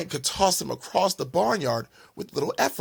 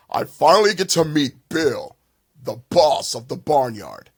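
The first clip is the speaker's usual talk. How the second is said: big booming bull voice, louder; voice like thunder; 6 dB louder than usual. Acted. Giant bull greeting the bully: booming thunderous voice. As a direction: boom it out like thunder. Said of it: "A voice like thunder".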